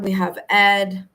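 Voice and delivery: normal talking voice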